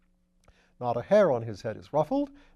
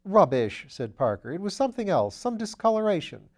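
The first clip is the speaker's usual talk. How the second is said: loud, higher scoffing protest; loud dismissal; 6 semitones higher, 6 dB louder than usual. Acted. Parker scoffing at Lord Peter's soap theory, loudly. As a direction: brush it off, raising the voice.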